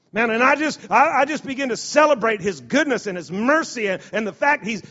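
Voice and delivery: high, loud rising sermon; full-force preaching. Acted. A preacher rousing his congregation, loud and high. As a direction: build it up to a shout from the pulpit.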